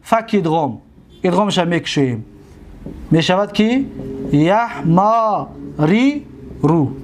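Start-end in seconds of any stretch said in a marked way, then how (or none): 3.1-6.9 یحماری رو stretched out, every syllable held; teacher models یحماری رو drawn out; slow demonstration. Shown as a demonstration. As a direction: sound out یحماری رو slowly for the class. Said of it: "دیدید؟".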